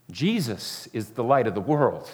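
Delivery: ordinary talk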